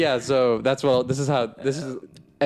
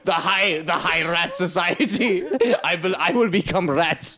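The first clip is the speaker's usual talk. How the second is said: hammed-up German accent, higher, laughing through it; a mad scientist in a German accent, cackling; 7 semitones higher than usual. Wolf Blitzer joking about rat experiments, in a German accent. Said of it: "That's a German accent".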